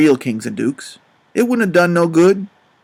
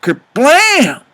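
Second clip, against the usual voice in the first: imitated slam; 7 semitones higher, 5 dB louder than usual. Imitating the door slamming.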